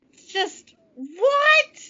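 very high incredulous yelp; stunned disbelief. Real, not acted. Host speechless at the plot, high incredulous cry. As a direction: let it leap up in disbelief.

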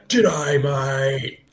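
cartoon Australian impression; Australian accent. Shown as a demonstration. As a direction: put on a hammed-up Australian accent. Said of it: "This is me doing an Australian accent".

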